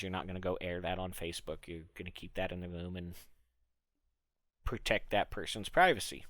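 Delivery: ordinary talk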